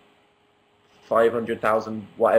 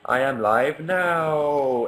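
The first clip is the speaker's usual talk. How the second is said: excited announcement; 3 semitones higher, much slower than usual. Shown as a demonstration. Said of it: "Exclamation mark".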